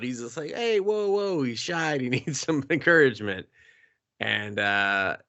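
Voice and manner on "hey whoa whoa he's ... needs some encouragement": high, bashful protesting voice; sheepish guy. Retold or imitated. Larson excusing himself to her, high and sheepish. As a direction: backpedal in a high, embarrassed squeak.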